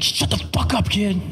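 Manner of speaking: high, put-on snap at a child; hammed-up exasperated parent; mock angry dad. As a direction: fire back in mock outrage. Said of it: "And I'm like".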